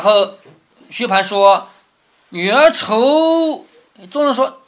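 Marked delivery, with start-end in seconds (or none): 2.3-3.7 high, loud and hugely stretched syllables; drawn-out recitation. Acted. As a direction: proclaim it slowly, holding the last word high. 薛蟠念第二句酒令. (高声拖长腔念酒令)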